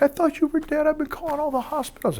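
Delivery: high-pitched mock-tearful wife voice; imitating crying wife. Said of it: "She's crying, like".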